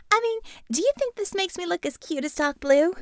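put-on a bubbly young fan fishing for a compliment; young woman asking to be told she looks cute. Co-ed asks Corby about looks.

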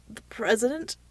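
hesitant, rising question; nervous gulp. A narrator's reading. Green-faced man fearing the call, nervous. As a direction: ask it timidly, swallowing nerves. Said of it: "asked one ... with a gulp".

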